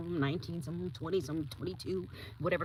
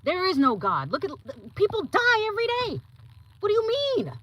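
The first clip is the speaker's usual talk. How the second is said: mock scornful atheist; 7 semitones higher, 3 dB louder than usual. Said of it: "they're looking at you like".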